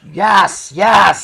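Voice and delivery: high, loud gloating yell; screaming yes. Retold or imitated. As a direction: shout it like a cocky winner. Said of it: "screaming about how he just won".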